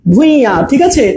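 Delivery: usual speaking voice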